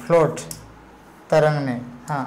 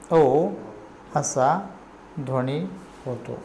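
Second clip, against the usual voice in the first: ओ, असा, ध्वनी, होतो each said alone with a pause after; word-by-word dictation; 3 semitones lower, 8 dB quieter than usual. Shown as a demonstration. Teacher dictating ओ असा ध्वनी होतो to the class, one word at a time.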